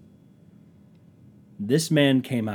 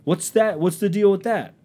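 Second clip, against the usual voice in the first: high, loud worked-up complaint; acted-out heated grievance, pitch climbing; mock indignant rant; 6 semitones higher, 6 dB louder than usual.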